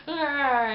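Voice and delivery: groaning grunt. Retold or imitated. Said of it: "he's like".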